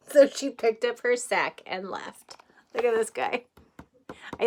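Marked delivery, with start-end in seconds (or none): none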